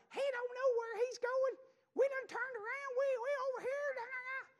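high whiny mock-sobbing daughter's voice; mimicking crying daughter. Father imitating his upset daughter on the phone, whiny and high. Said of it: "She's snotting and carrying on".

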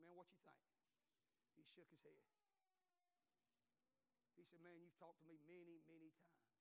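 low, hushed and defeated; subdued inmate. Retold or imitated. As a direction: let it sink, voice dropping away.

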